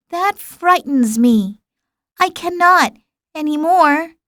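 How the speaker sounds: mild abashed murmur. A narrator's reading. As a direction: shrink back like a frightened boy. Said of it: "murmured the little prince".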